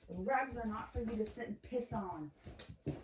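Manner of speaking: sharp high scold from across the room; yelling at a puppy from a distance. Real, not acted. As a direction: tell the dog off, exasperated.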